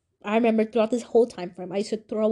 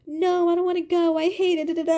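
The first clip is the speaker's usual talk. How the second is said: put-on higher, whining refusal like a tearful kid; whiny crying protest; 7 semitones higher than usual. Refusing her mom's wake-up call, mild imitated crying whine. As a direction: plead not to go, half-imitating the tears. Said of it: "And I just started crying".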